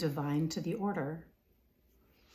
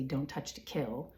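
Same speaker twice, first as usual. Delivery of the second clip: ordinary delivery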